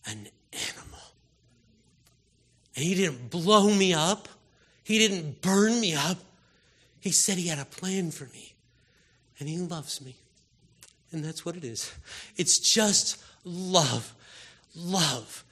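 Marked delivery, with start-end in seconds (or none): 0.1-1.0 dropping to a voiceless hush; hushed quote. Retold or imitated. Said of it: "And Paul is like". 12.4-15.4 very slow, lingering on each word; slow, lingering sermon line; drawn out. Real, not acted. Preacher dwells on love.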